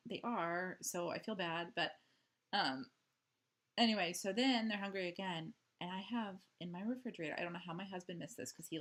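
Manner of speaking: normal talking voice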